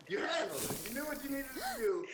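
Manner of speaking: high-pitched burst of congratulation; excited praise. Real, not acted. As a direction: cheer her on, pitch climbing sharply. Host celebrates the guest's speech.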